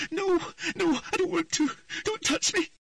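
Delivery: put-on high, desperate girl's cry; terrified pleading. A narrator's reading. Rigid Ciri begs the unseen figure not to touch her. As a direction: shrink away and beg. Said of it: "The girl turned rigid".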